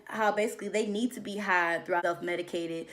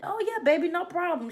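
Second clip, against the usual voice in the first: mock sweet husband; 7 semitones higher than usual.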